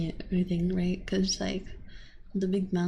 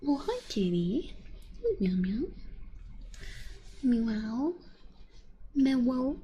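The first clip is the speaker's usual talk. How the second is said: cooing at cat; 3 dB quieter, slower than usual. Real, not acted.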